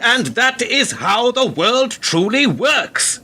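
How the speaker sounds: sudden high, slow angry outburst; lost temper. Acted. Father to his son over the moral high ground, angry outburst. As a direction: snap and let the temper break loose. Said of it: "I should not have lost my temper".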